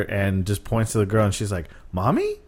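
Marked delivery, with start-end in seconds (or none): 1.9-2.4 high questioning little-kid imitation; imitated toddler spotting mom, pitched way up; three-year-old daughter. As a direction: lift it sharply like a puzzled small child. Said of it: "her three-year-old daughter".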